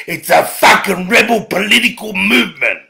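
ordinary delivery

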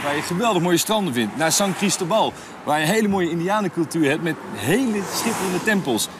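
ordinary talk

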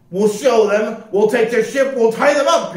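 rally the crew with a roar. A narrator's reading. Dirty Joe roaring to rouse his men, loud. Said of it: "he roared".

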